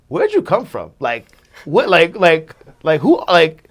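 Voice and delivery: high, loud, fumbling for the question; excited, stumbling curiosity; retold amazed questioning. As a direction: imitate someone baffled and impressed. Retold star quizzing him, amazed.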